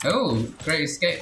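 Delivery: ordinary talk, nothing marked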